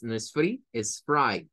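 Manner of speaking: ordinary talk, nothing marked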